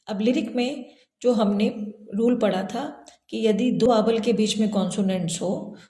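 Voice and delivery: normal talking voice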